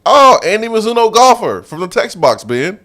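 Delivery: high, loud sudden realization; light up as you place the name; excited recognition. Retold or imitated.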